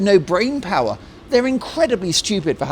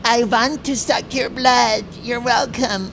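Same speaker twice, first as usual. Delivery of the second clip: higher, slow mock vampire voice; Count Dracula; 6 semitones higher, slower than usual. Host casts Meghan as a vampire, slow Dracula parody. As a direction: draw it out as a hammed-up vampire. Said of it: "She is a modern day Count Dracula".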